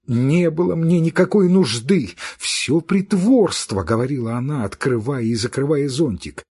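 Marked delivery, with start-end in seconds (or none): 0.1-3.8 angry outburst. Acted.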